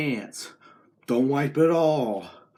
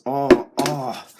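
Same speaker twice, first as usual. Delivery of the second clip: rising panic; 5 semitones higher, 7 dB louder, slower than usual. Acted. Sanitizer goes wrong, rising panicked yelps. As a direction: voice climbing higher and louder in mock panic.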